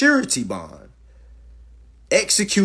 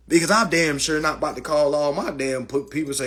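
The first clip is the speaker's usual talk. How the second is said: ordinary delivery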